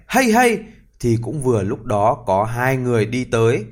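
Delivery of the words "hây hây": high, loud call to the horse; shout. Acted. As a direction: yell it to drive the horse. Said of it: "tí hon đang thét".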